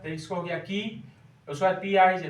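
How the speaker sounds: usual speaking voice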